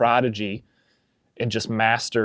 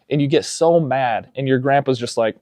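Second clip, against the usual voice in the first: normal talking voice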